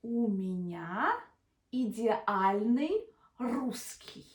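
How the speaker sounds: "У меня идеальный русский" very slow; slow careful demo. Shown as a demonstration. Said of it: "the way you say it is".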